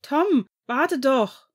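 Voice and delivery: loud, high, drawn-out call; calling after someone. A narrator's reading. Ian to Tom running ahead in the dark, urgent call. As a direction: shout for him to wait, stretching the words.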